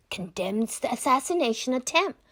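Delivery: ordinary delivery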